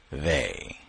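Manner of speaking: "they" set apart by pauses, drawn out and falling; stress on THEY. Shown as a demonstration. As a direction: hold "they" as if in italics. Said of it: "for emphasis".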